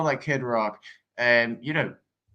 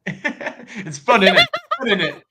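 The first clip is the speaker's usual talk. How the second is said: mock British accent; 7 semitones higher, 5 dB louder, slower than usual. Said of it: "a little bit of a British accent".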